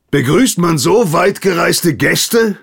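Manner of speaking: high, loud indignant blurt; outburst. Acted. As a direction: fire it out before thinking. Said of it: "schoss es aus Hagen heraus".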